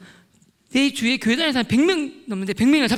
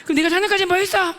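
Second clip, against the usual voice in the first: loud, high accusing question; shouted interrogation; 7 semitones higher, 6 dB louder than usual. Retold or imitated. Confronting a classmate about his past, acted angry shout. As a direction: hurl it as an accusation.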